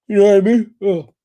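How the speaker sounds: yawning through the words. Real, not acted. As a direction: talk through a yawn. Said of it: "Forgive me, the yawning".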